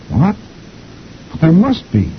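high-pitched wifely disbelief; protesting wife. A narrator's reading. Wife disputes the usher's news, high-pitched protest. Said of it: "And the wife says".

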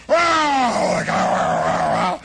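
loud howl breaking into repeats; imitated howl. Storyteller imitating the driver after scraping the Buick, loud rolling howl. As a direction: let out a big mock howl, then keep it rolling. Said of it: "He goes".

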